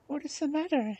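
high-pitched, kindly princess voice. A narrator's reading. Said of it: "she said in the kindest way".

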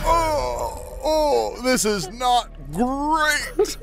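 high, slow dying groan; Tony the Tiger. Acted. Wounded tiger twisting his catchphrase, high drawn-out voice. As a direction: drag the catchphrase out in dismay. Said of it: "I'm friends with Tony the Tiger".

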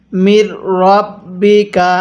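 drawn-out chanted reading of মির রব্বিকা; slow Quranic recitation. Shown as a demonstration. Teacher recites the verse word slowly for students. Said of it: "তাহলে এবার এখান থেকে".

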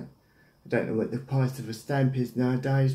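ordinary talk, nothing marked

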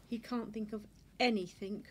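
plain reading voice

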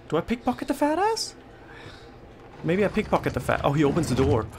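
ordinary talk, nothing marked

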